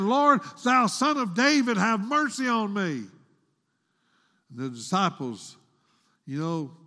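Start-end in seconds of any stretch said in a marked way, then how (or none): 0.0-3.0 high imitated cry for mercy; pleading cry. Woman begs Jesus over and over, pleading cry. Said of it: "she's pleading, repeatedly saying".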